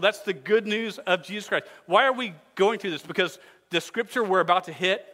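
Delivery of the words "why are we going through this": ordinary talk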